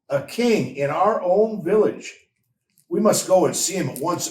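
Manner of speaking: stirred up to go at once; excited. A narrator's reading. The shepherds hear of the newborn king, excited.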